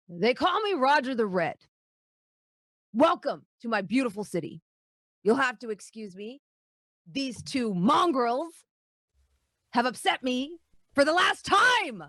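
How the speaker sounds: rising to a shouted threat; pompous dwarf lord. Acted. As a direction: puff up, then roar. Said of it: "he draws himself up ... and he says".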